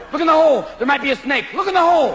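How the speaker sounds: peer into the cup, wired; wired golfer. Acted.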